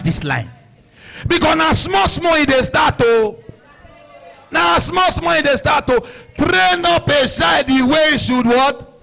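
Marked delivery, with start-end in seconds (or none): 1.3-6.0 preaching. Acted. Preaching moral. 6.4-8.9 preaching scripture. Acted. Quoting scripture.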